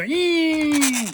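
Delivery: high held squeal; toy screech. Retold or imitated. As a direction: squeal it like a little toy monster. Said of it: "That's what he sounded like".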